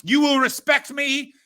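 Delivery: loud, drawn-out wrestler-style demand; high, slow, forceful demand for respect; Elon Musk. Acted. Elon playing WWE aggressor, loud and commanding. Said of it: "seems like he's just like".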